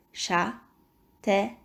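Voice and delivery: Châtaigne. slowly split, final gne sounded; syllable by syllable. Shown as a demonstration. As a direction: repeat "Châtaigne." as châ, tai, gne. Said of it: "taper les syllabes".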